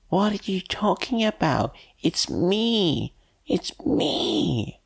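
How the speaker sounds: high, hurt, pleading woman's voice. Acted. The woman insisting to Egon, high and hurt. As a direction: plead to be recognised.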